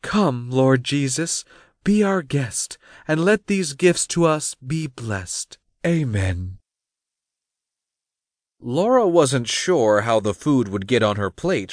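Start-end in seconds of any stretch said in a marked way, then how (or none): 0.0-6.6 high, measured table grace; recited mealtime prayer in a high voice; short prayer. A narrator's reading. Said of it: "led them in a short prayer".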